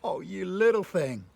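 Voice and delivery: put-on loud, delighted cooing to a toddler; rejoicing big brother. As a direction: gush with fond joy over a little one. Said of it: "rejoicing as they take those first steps".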